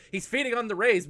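yelling. Retold or imitated. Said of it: "yells Moltar".

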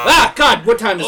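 loud, high-pitched panicked yell; panicked shout. Acted. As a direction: cry out in sudden panic. Panicked waking at night.

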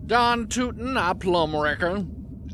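very slow, stretched Southern drawl. Acted. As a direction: drag out every word, Southern style. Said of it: "Did you become Southern".

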